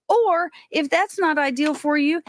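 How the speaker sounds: ordinary delivery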